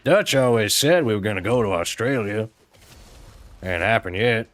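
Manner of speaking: lightly voiced cowboy outlaw, wistful. Outlaw recalling Dutch's promise of Australia, lightly drawled.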